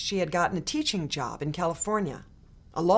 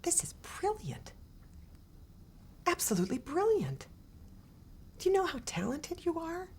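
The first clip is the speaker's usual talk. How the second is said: soft, much higher hushed encouragement; whispered praise; 11 semitones higher, 9 dB quieter than usual. A narrator's reading. Teacher whispering behind Tricia as she draws, hushed. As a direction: breathe the praise into her ear.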